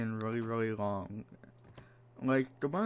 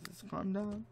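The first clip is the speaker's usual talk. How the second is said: a hint of a higher, soothing Tiger Sims voice; calm yourself down in a put-on high voice; 9 semitones higher, 4 dB quieter than usual. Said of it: "Tiger Sims says".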